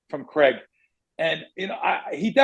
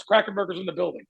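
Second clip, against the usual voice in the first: usual speaking voice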